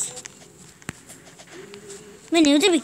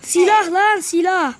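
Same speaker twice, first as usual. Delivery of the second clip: excited; 4 semitones higher than usual. Real, not acted. Spots a gun in game.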